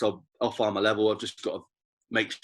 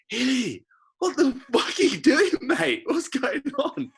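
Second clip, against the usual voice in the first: high-pitched exasperated shout, imitating a coach; mock outraged yell; 3 dB louder, slower than usual. Coach to Hilly after he dropped the ball, mock outraged.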